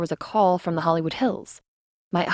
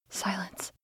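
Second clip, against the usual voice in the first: usual speaking voice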